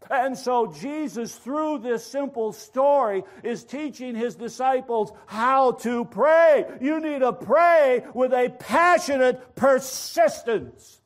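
forceful preaching, slowing on the final words. Real, not acted. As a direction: drive each phrase home to the congregation.

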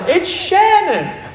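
high, slow surprised call of the name; excited recognition. Retold or imitated. Eli spots the pizza driver, a high delighted call. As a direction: spot him and call it out. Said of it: "And Eli goes".

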